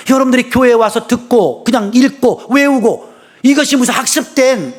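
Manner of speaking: fervent, rising sermon voice; preaching. Real, not acted. Pastor urging the congregation to keep faith, fervent. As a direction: preach it with fire.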